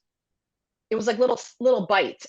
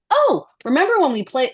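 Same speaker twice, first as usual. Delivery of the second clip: host voice; 8 semitones higher, 4 dB louder than usual. Retold or imitated. Imitating Blue's Clues recap voice.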